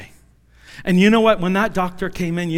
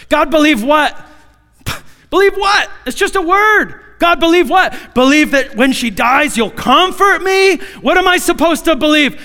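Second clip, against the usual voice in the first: pleading shout; 8 semitones higher, 6 dB louder than usual. Retold or imitated. Arguing with God.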